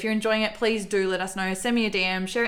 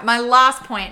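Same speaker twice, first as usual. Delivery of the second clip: louder, falling call for the last point; emphatic announcement; 3 semitones higher, 8 dB louder, much slower than usual. Real, not acted. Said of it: "Okay, all right".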